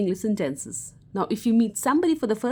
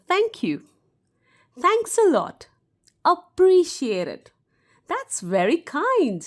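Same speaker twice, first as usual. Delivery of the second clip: bright thanks; 8 semitones higher, 4 dB louder, slower than usual. Shown as a demonstration. Teacher demos thanking someone.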